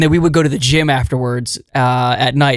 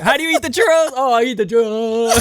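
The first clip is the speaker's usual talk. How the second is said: higher, exaggerated comic impression; Charles Barkley; 7 semitones higher than usual. Co-host imitating a celebrity eating churros, put-on comic voice. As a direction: mimic him hamming it up about food. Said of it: "like the Charles Barkley thing".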